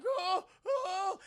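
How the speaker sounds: high mock whimper of fear; mock frightened whimper. The boy being strapped in, mock frightened. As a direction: squeak it like a scared child. Scared kid voiced.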